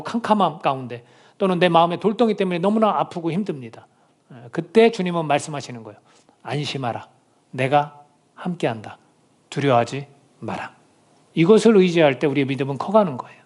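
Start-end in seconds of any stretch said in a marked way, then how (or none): 6.4-10.7 soft, slow comforting words; a gentle voice telling them not to fear; gentle reassurance. Retold or imitated. Jesus comforts the troubled believer, soft and slow.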